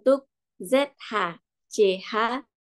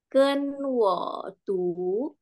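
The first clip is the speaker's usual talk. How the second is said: very slow, drawn-out Mandarin cue; leading class repetition; 2 semitones higher, much slower than usual. Shown as a demonstration. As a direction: stretch each syllable for the class to follow. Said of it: "Coba sama-sama".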